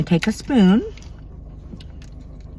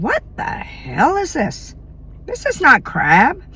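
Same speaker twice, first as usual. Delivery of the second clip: outraged disbelief; 7 semitones higher, slower than usual. Retold or imitated. Retold restaurant complaint, outraged.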